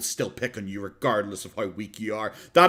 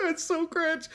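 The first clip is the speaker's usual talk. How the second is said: laughing; 5 dB quieter, slower than usual. Real, not acted.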